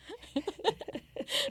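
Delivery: high, light giggle; laugh. Real, not acted. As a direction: burst of quiet high laughter. Ri da própria fala com Deus. (risada aguda)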